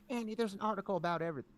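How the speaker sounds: high-pitched mother impression; complaining mom. Imitating his mom's complaint.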